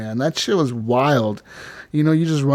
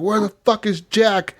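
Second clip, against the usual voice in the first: higher, drawn-out retold panic; freaking out; 7 semitones higher than usual. Mother whose Jack is missing, freaking out. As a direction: act out a frantic demand, pitch raised. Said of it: "She's freaking the fuck out".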